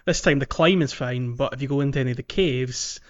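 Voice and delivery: usual speaking voice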